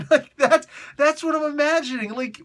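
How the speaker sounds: high laughing voice. Real, not acted. Host laughing at his imagined meeting. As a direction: laugh the words out. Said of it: "laugh heard, high".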